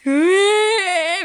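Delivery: long, loud, high "ugh"; drawn-out mock cry of revulsion; creeped-out groan. Host recalling first hearing Tokyo speech, creeped-out groan. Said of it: "ゾワゾワーって感じだったのに".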